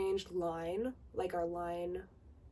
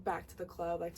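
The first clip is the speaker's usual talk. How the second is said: ordinary talk, nothing marked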